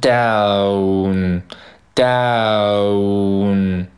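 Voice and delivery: "down" very drawn out, ending in a long n; overdone slow demo of "down"; exaggerated. Shown as a demonstration. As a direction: stretch "down" slowly into its nasal ending. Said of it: "我读比较夸张哈".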